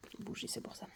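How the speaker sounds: breathy self-talk, barely voiced; whispered aside. Real, not acted. Player realizing her mistake, whispering to herself. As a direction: whisper it to yourself. Muttering to herself while solving.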